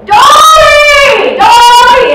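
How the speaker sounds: frantic high, very loud holler; frantic shouting. Acted. Resident can't find his wife and shouts, frantic. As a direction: scream the name, increasingly desperate. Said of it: "he's getting more frantic".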